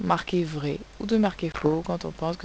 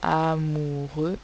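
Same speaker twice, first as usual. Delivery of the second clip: slow amoureux; slower than usual. Shown as a demonstration. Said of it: "on n'entend absolument pas le son est".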